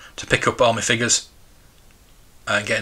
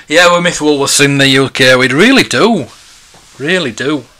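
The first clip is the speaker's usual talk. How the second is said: loud lament; 3 semitones higher, 9 dB louder than usual. Real, not acted. Lamenting lost Woolworths.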